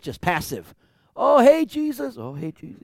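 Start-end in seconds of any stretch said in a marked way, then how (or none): 1.1-2.2 high, loud sneering call; mocking taunt. Passerby taunting Jesus on the cross, high mocking call. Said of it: "hurled insults at him". 2.2-2.8 quiet muttered taunt. Shown as a demonstration. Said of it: "not just, like, whispering".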